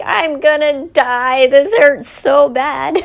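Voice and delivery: hammed-up slow, loud complaint; melodramatic child. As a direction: play a hurt child overdramatically. Child exaggerating a scraped knee.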